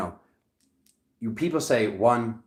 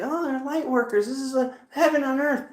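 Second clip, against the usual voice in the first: high, gushing mimicry; mock their bliss in a high voice; mocking imitation; 12 semitones higher than usual. Reader mimicking Sedona light workers, high mocking voice.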